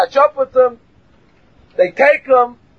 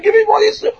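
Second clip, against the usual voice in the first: high desperate yell; screaming; 13 semitones higher, much faster than usual. Retold or imitated. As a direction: scream it out in the dream. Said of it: "And he's screaming in his dream".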